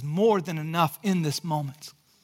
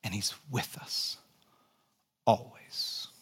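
hushed, breathy, and low; whispered; 11 semitones lower, 9 dB quieter than usual. Real, not acted. Pastor to congregation about Christ's presence, hushed whisper. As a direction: let it fall to a whisper.

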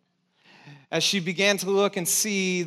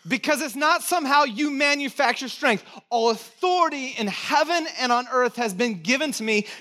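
raised, ringing preacher voice; full-force preaching; 8 semitones higher, 4 dB louder than usual. Acted. Pastor preaching scripture to his church, full force. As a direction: preach it out to the room.

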